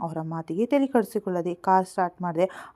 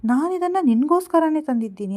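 higher, louder, taken aback; surprised protest; 6 semitones higher, 4 dB louder than usual. A narrator's reading. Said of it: "ಆಶ್ಚರ್ಯದಿಂದ ನಾನು".

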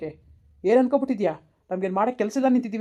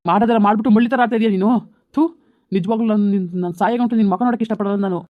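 disgusted scolding; 7 dB louder than usual. Acted. Husband curses wife in disgust.

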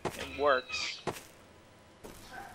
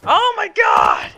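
shout; 13 semitones higher, 12 dB louder, much slower than usual. Real, not acted. Killed by another player.

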